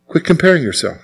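preacher quietly repeating his point; soft reminder. Acted. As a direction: let it land gently. Repeated exhortation, softened.

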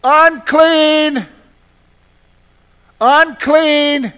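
loud calling cry, pitched high; yelling out. Pretend. Leper crying out to approaching people, loud warning. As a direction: call out a warning to strangers. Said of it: "I would have to yell out".

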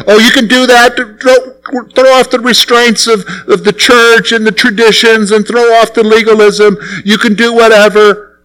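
a voice selling easy freedom, a bit hammed up; mock tempting preacher. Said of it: "That's what they're saying here".

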